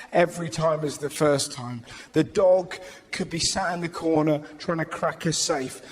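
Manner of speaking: ordinary talk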